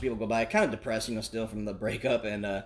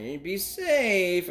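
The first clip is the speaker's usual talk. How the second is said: mom's warning; 6 semitones higher, 4 dB louder, much slower than usual. Retold or imitated. Mom on the phone, skeptical.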